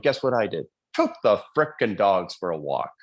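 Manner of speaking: ordinary delivery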